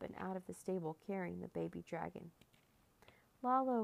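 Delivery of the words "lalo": higher, louder cry of a name; narrator's contained, anguished call. A narrator's reading. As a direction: cry out after someone being taken. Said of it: "Carlos cried".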